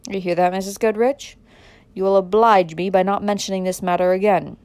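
loud, cold rebuke; forceful, domineering order; stern command. A narrator's reading. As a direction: lay down the law to a wife. Stern husband forbids wife further mention.

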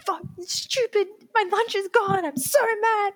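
hammed-up tantrum over a stolen lunch; mock hangry outburst. Retelling her rage when coworker tossed her lunch, mock tantrum.

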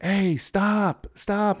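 high mock yell at a racing tank driver; yelling soldiers. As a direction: shout him down, playfully retold. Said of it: "all these dudes are yelling at him".

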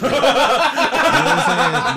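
laughing through the words. Real, not acted. Laughing while talking.

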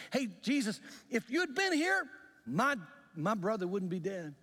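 high-pitched, accusing complaint; acted reproach. Sister greeting Jesus after her brother's death, acted high-pitched reproach. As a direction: confront him as the aggrieved sister, pitch jumping up.

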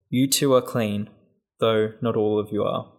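ordinary talk, nothing marked

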